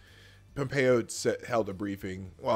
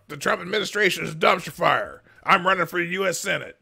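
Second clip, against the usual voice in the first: loud, raised candidate proclamation; mock campaign ad; 6 semitones higher, 5 dB louder than usual. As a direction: announce it like an overheated candidate. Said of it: "the guy who had the dumpster fire ad".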